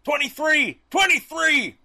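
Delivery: high, loud chanting yell. Retold or imitated. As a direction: holler it out at full pitch, falling off at the end.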